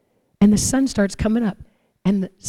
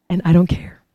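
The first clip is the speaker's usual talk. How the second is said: whisper; 3 semitones lower than usual. Real, not acted.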